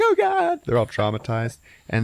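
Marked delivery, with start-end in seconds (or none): 0.0-0.6 very high cartoon wail; mock horror. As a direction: cry out like a horrified cartoon character. Food reacting in horror, retold.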